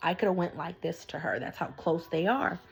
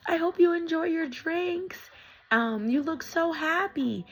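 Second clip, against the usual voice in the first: higher-pitched voice of an excited daughter; figlia entusiasta imitata; 10 semitones higher than usual. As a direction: imitate a cheerful girl, pitched up. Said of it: "the daughter goes".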